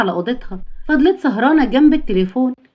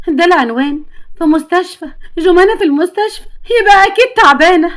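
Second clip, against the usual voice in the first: rising higher and louder in panic; alarmed mother; 5 semitones higher, 4 dB louder than usual. Acted. Mother reads the hospital address, rising panicked alarm. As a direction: let the worry climb with each phrase.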